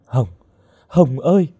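sobbing call. A narrator's reading. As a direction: call the lost friend's name with a touch of weeping. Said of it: "khóc nức nở". (gọi tên trong tiếng khóc, nhè nhẹ)

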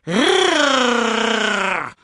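high, feeble attempt at a growl; cub's high-pitched mock roar; squeaky roar. A narrator's reading. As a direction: squeal out a long trilled roar. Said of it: "quiekte er".

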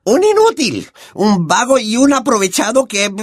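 very high, scornful aunt voice; Aunt Marge, scornful. Acted. Aunt runs down Harry's father, high scornful voice. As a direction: spit out the insults with contempt.

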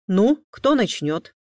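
loud, slow stern demand; a touch of the strict father; stern question. A narrator's reading. Father questions the guilty twins, stern. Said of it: "строго спросил отец".

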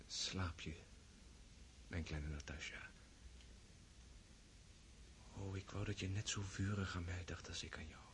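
put-on soft whisper to a sleeping girl; hushed night-time yearning; whispered longing. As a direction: breathe it so she won't wake.

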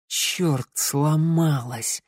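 under-the-breath, drawn-out dismay; cursing. A narrator's reading. Theo sees the bird broken, cursing. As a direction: swear to yourself in frustration. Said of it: "выругался Тео".

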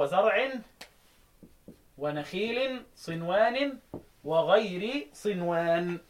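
slow, drawn-out chanted recitation; Quran recitation. Shown as a demonstration. Teacher intoning the verse for students, slow and chanted.

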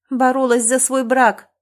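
loud, slow, hurled reply; shouting back. A narrator's reading. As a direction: yell it back at him. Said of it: "также кричит она". (кричит в ответ)

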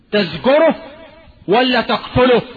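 loud and high, pressing the listeners; emphatic question. Real, not acted. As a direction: demand an answer from the crowd. Rhetorical question to the congregation.

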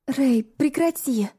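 slow, breathless plea; out of breath pleading. Acted. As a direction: beg him to stop, panting. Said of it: "тяжело дыша, взмолилась я".

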